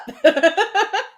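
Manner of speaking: high, loud burst of laughter; real laugh. Real, not acted. Host cracking up after reading a viewer's greeting, laughing.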